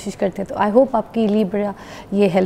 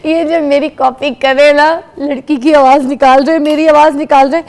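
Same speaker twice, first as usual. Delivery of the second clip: excited; 4 semitones higher, 8 dB louder than usual. Real, not acted. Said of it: "excited laughing reaction".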